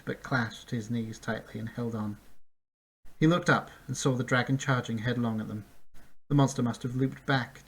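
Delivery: usual speaking voice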